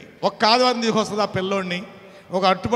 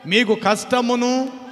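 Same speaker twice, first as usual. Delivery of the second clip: higher, louder, slow scripture proclamation, near a shout; louder and higher, preaching the storm of judgement; preaching at full force; 6 semitones higher, 5 dB louder than usual. Acted. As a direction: thunder out God's warning.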